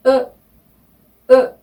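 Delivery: 으 twice with lips in an o shape; rounded "eu" repeated; French eu vowel. Shown as a demonstration. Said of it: "이랬죠".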